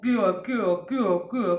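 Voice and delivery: loud mocking taunt, a put-on tough; a light mock of a pushy boy; rowdy taunt. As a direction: needle them like a cocky thug.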